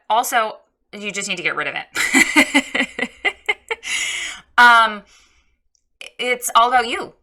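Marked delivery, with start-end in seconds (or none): 3.1-4.5 laughing. Real, not acted. Laughs at own critique.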